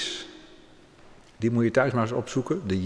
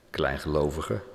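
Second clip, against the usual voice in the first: a quiet, lower and softer Jezus tenderly chiding; soft, much lower rebuke; 10 semitones lower, 6 dB quieter than usual. A narrator's reading. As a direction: speak "Kleingelovige" low and soft, like a gentle reproach. Jesus gently rebukes Peter. (zachte, lage berisping van Jezus)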